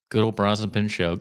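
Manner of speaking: normal talking voice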